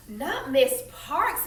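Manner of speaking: slow, stretched-out dismay on Parks; exclaimed. Acted. Miss Robinson learning Rosa Parks was arrested, in slow dismayed disbelief. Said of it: "Miss Robinson exclaimed".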